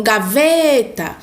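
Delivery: gaveta with VE held long; calling out. Shown as a demonstration. Teacher showing which syllable lasts longest, calling out. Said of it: "fica por mais tempo na minha boca".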